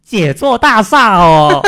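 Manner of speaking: 杰作大厦 in a sing-song Cantonese Mandarin, slow; slow, lilting Cantonese-accented phrase. Retold or imitated. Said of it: "广东人他那个普通话说的不好".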